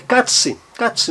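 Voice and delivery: katse with a short A; short A demo. Shown as a demonstration. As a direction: clip the A in katse. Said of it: "um som breve". (A breve em katse)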